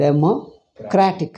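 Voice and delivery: "democratic" slow, stress on "cra"; slow, careful "democratic", CRA stressed; stress on cratic. Shown as a demonstration. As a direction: drag it out and hit "cratic". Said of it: "The stress is on cratic".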